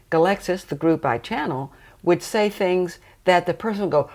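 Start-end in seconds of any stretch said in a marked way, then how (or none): none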